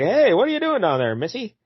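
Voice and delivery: folksy gas man. Retold or imitated. Gas man calling down the well to her, folksy. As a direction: play a cheery small-town worker. Voicing the gas company guy.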